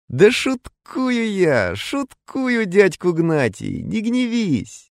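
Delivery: slow, high tipsy slur; drunk voice. A narrator's reading. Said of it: "продолжил хмельным голосом".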